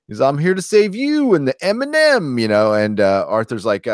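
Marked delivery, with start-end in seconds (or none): 0.2-2.4 mock drunk. Drunk Superion arrives before the countdown ends, slurred mock heroics. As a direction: play a drunk hero swooping in, vowels stretched. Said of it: "acting drunk".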